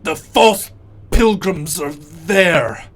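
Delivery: slow, loud, drawn-out warning; Bubblegum's strained voice. Acted. Walrus struggling, warns the giant, slow and loud. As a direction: drag each word out like a possessed creature. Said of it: "he goes".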